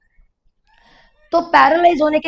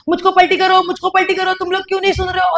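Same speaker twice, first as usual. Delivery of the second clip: imitating granny's yells; 6 semitones higher than usual. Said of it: "फिर से चिल्लाती थी".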